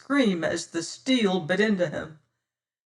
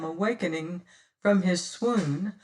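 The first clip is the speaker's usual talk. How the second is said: plain reading voice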